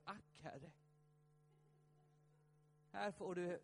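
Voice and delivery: ordinary delivery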